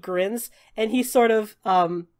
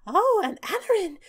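high, bright fairy voice; squeaky little fairy greeting; tiny pixie voice; 10 semitones higher than usual. Acted.

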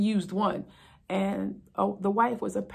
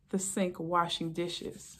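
normal talking voice

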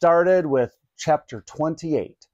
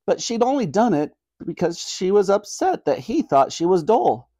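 ordinary delivery, nothing marked

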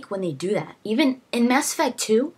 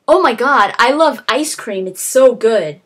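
louder, like a stiff game hero; mock same-tone line; 2 semitones higher, 7 dB louder than usual. Mocking Deus Ex hero's unchanging delivery, loud. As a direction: say it loud, same tone whatever the words. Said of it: "he could be saying".

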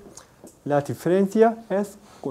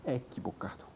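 whisper; 4 semitones lower, 17 dB quieter than usual. Real, not acted. Teacher's quiet aside.

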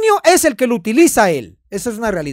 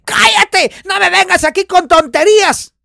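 mock angry quote; 6 semitones higher, 4 dB louder than usual.

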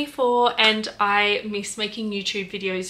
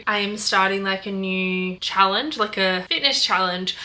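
usual speaking voice

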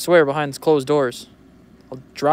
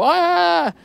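mock scream. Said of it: "and you scream".

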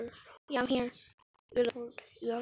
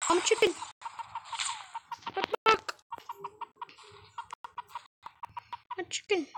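mock chicken voice; 10 semitones higher, much faster than usual. Scared gamer mocking himself as a chicken, high and laughing. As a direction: squawk it out like a giggling coward.

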